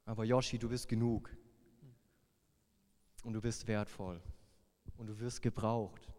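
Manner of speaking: soft, low, reassuring murmur; quiet gentle voice. Retold or imitated. The shepherd's voice comforting Yoshi, softly and low. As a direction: tell him gently he is enough. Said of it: "diese leise Stimme".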